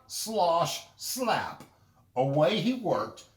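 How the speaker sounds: ordinary delivery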